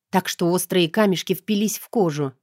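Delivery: ordinary delivery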